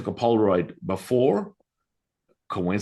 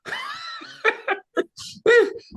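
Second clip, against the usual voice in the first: long, high acted laugh; incredulous laugh. Healer hearing the mother's verdict, acting an incredulous laugh. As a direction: break into high, disbelieving mock laughter. Acting out his amazed reaction.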